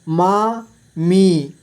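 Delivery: मामी split slowly into मा, मी; syllable by syllable. Shown as a demonstration. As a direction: stretch and separate मा and मी in मामी. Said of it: "इस तरह से आपको". (मामी को तोड़कर धीरे: मा, मी)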